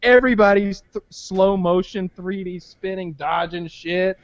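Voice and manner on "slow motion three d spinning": ordinary talk, nothing marked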